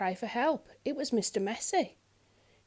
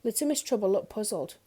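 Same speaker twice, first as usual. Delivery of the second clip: ordinary delivery, nothing marked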